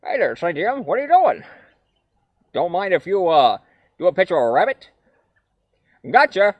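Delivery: cartoon rabbit, a touch Woody Woodpecker; early rabbit voice. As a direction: play the annoying early rabbit. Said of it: "he sounds like".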